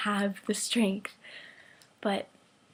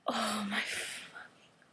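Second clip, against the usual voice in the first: overwhelmed whisper; 10 dB quieter than usual. Real, not acted. Fan swooning over his promise, overwhelmed whisper. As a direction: whisper it, completely overcome.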